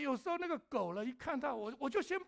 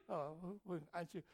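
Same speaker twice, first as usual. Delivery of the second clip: ordinary delivery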